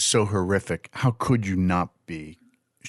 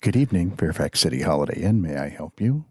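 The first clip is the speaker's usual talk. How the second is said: low sexy operator voice; 6 semitones lower than usual. Retold or imitated. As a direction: purr it like a flirty receptionist. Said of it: "lower and sexier with the dog".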